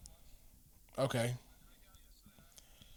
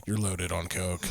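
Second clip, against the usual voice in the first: mom's low deadpan; 10 semitones lower, 14 dB quieter than usual. Retold or imitated. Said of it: "she was like".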